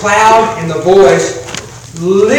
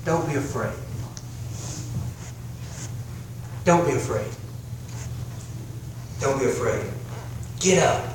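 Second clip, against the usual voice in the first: Jesus' gentle words; 4 semitones lower, 12 dB quieter than usual. Acted. Jesus comforts disciples, retold scene.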